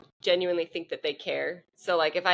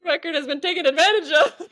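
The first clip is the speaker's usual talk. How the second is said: laughing; 9 semitones higher, 5 dB louder than usual. Real, not acted. Cracking up over the joke.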